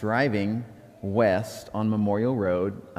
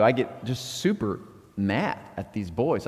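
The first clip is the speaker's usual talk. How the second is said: ordinary talk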